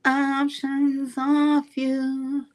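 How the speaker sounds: high, fed-up voice, slowing right down at the end; a put-upon sigh of a thought, spoken out; exasperated inner voice. Retold or imitated. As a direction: tell her off in your head, done with her. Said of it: "And I'm thinking to myself".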